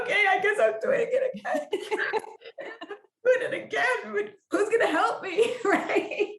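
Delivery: high-pitched, laughing surrender; laughing mock whimper. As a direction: squeal it through giggles, playing helpless resignation. Acts out reluctant acceptance, laughing.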